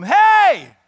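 high, loud call down the hill, slow on the second line; shouting down. Retold or imitated. Jonathan calling down to the enemy soldiers, loud and high. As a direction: holler it down to the enemy camp. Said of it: "We're gonna shout down to 'em".

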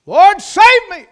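high, very loud cry for rescue; desperate shout. Acted. Sinking Peter crying to Christ for rescue, desperate shout. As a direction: shout it out drawn and urgent, as if sinking. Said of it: "He made an emphatic appeal".

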